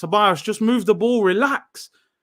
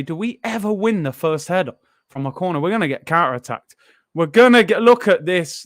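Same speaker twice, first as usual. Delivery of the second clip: ordinary talk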